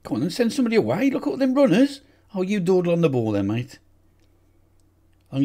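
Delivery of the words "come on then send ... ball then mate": high, fast yells at the screen; shouting at the players. Real, not acted. Frustrated manager yelling at his team on screen. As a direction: urge the runners on, loud and quick.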